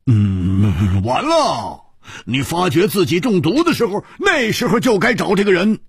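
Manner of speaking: hum, then rattle out the advice at a high pitch; impatient Hu. Acted. Hu scolding Liu for not seeking help, impatient.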